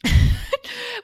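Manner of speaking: high, loud burst of laughter. Real, not acted. Host laughing over the brightness remark, high loud laugh. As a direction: crack up loudly. Amused during awkward segment.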